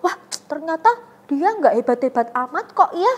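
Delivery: normal talking voice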